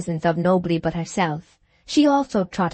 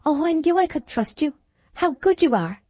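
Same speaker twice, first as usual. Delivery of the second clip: ecstatic relief; 8 semitones higher than usual. A narrator's reading. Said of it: "gave a little ecstatic cry".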